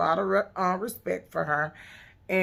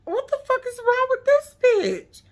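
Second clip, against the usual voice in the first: much higher, louder incredulous voice; mock T T; 5 dB louder than usual. T T reacting to Egypt's tantrum, incredulous. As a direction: play T T, baffled and fed up. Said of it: "Even T T was like".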